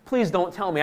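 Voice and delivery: ordinary delivery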